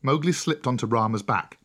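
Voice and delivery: usual speaking voice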